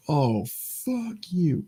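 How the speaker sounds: slow, soft, drawn-out hushed insult; whispered. Retold or imitated. Retelling what he said after the movie, slow hushed insult. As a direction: breathe it out slowly under your breath. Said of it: "don't know why I whispered it again".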